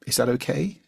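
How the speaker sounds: tentative upturn on "okay", seeking approval; asking permission. Shown as a demonstration. Coach voicing the unspoken question after each sentence, rising for permission. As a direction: lift "okay" into a questioning rise. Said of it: "it's kind of asking for permission".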